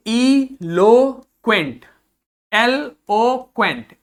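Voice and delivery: एलोक्वेंट drawn out slowly; slow model pronunciation. Shown as a demonstration. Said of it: "अगला देखिए". (धीमा उच्चारण)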